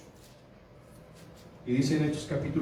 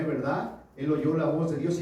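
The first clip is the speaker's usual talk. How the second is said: ordinary delivery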